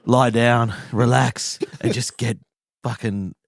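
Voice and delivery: low, smooth radio host voice; late-night radio host. Pretend. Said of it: "I'm just gonna introduce this section".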